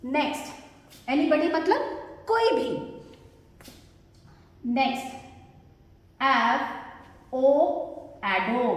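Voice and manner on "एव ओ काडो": "एफ ओ एडो" as slow separate sounds, lower; syllable by syllable. Shown as a demonstration. Teacher introduces word to learners, "एफ ओ एडो" low and split. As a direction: sound out avocado in pieces, "एफ ओ एडो".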